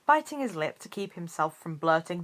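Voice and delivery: plain reading voice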